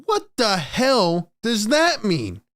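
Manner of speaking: slow, drawn-out disbelief; baffled. Real, not acted. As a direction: spell out your confusion. Baffled by the quote.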